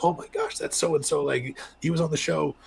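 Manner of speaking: high, excited imitation of a starstruck mom; gushing mom. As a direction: imitate the mom spotting him, voice pitched high. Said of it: "And she was like".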